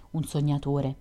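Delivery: usual speaking voice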